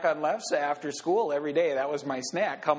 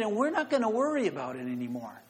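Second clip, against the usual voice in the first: ordinary delivery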